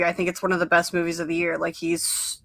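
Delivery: ordinary delivery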